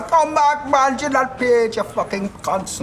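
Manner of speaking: high-pitched West Indian shout, imitated; West Indian ticket collector. Ticket collector chasing fare dodgers over the barriers, mock West Indian shouting. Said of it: "chased by some old West Indian ticket collector".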